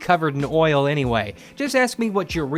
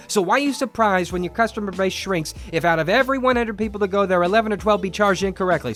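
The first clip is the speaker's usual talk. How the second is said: fast, lightly put-on street accent; quick, light slangy street accent. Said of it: "I'm doing that accent thing".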